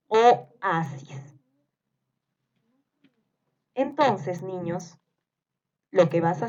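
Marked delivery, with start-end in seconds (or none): 0.1-1.3 "Oasis" broken as o, pause, asis; syllable-split dictation. Shown as a demonstration. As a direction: detach the first vowel of "Oasis". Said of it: "les voy a ir dictando". (dictado separando la "o" de "Oasis")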